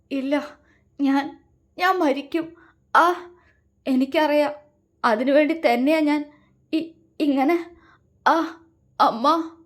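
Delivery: gasping, broken dying words; writhing in pain. A narrator's reading. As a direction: force each word through the pain. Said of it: "Angel പിടഞ്ഞുകൊണ്ട് പറഞ്ഞു". (പിടഞ്ഞുകൊണ്ടുള്ള സംസാരം)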